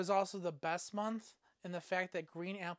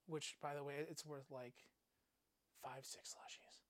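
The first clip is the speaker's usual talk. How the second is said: whispered aside; 4 semitones lower, 15 dB quieter than usual. Real, not acted. Muttered aside.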